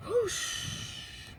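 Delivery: breathy rushing whoosh; whoosh sound. A narrator's reading. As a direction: blow out the swoop of wings. Dragon launched.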